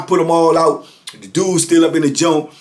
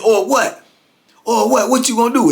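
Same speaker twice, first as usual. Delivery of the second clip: higher-pitched confrontational comeback; retold challenge to a fight; imitated challenge; 6 semitones higher than usual. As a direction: square up to him, pitched high. Customer challenges another man.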